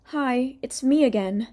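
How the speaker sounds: lightly mocking cutesy greeting; a hint of fake quirky charm. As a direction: play it faux-cute and sweet. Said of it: "She's trying to be, like, a little quirky".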